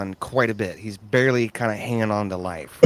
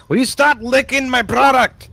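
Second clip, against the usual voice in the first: high, loud exasperated scold; indignant scold; 8 dB louder than usual. Acted. Farmer scolding a player licking his butter walls, high indignant scold. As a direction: snap at the pest licking the walls.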